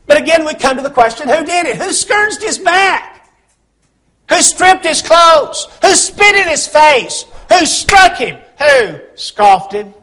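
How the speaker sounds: ringing pulpit cry, pitched high; impassioned preaching. Real, not acted. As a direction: press each question on the congregation. Preaches a string of accusing questions.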